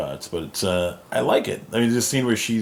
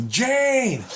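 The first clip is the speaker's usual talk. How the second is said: very high imitated shout; mock calling out. Gwenpool halting Thor mid-fight, high mock call. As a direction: cry out a name to stop someone.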